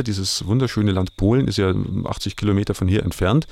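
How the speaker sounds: normal talking voice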